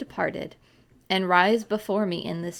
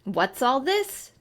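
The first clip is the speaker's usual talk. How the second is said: peremptory; 5 semitones higher, slower than usual. A narrator's reading. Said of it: "demanded another voice peremptorily".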